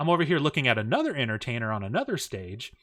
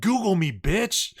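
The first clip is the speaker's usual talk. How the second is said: raised, higher mock challenge; imitated brash taunt; defiant boast; 6 semitones higher, 4 dB louder, slower than usual. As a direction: throw it out as a cocky dare. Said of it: "And then she said".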